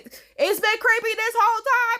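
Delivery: high-pitched emphatic complaint; worked-up, incredulous insistence; exasperated outburst. Real, not acted.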